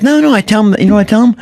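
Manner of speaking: Jay Leno impression. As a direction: do a bad, hammy Jay Leno. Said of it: "That's a horrible Jay Leno".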